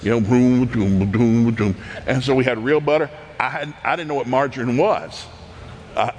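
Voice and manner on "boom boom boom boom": churn thumps. Retold or imitated. Imitating a butter churn.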